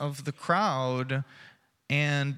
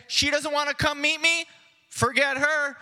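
higher, louder brush-off; dismissive; 8 semitones higher, 5 dB louder than usual. Retold or imitated. Pastor imagining Jesus brushing Mary off, dismissive and louder. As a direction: toss her aside with a scoff.

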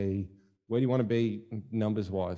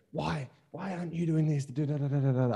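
higher-pitched nagging complaint, trailing into da-da-da; mock nagging; 7 semitones higher than usual. Bandleader getting on at bandmates who aren't pulling weight, mock nagging. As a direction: imitate someone badgering bandmates in a raised pitch.

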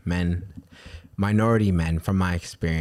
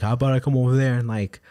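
retold threat; 5 semitones higher than usual. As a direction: play the guy squaring up. Retelling the guy's threat.

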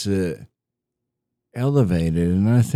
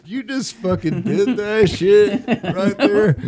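high, laughing through words; cracking up while talking; 11 semitones higher than usual. Real, not acted. Friends cracking up on podcast.

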